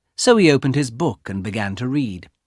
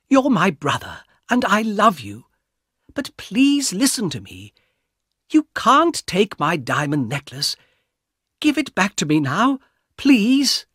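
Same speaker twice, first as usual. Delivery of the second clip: pleading sister; 9 semitones higher than usual. Acted. Young woman begging her brother, pleading and higher. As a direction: plead with him to give it back.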